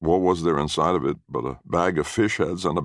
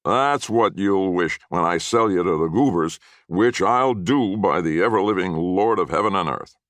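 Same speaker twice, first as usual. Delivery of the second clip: put-on higher and louder, a mean old man swearing; menacing oath; 5 semitones higher, 4 dB louder than usual. A narrator's reading. Grandfather swearing to the boy he'll sell him, menacing and loud. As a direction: swear it with grim relish. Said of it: "his mean little eyes".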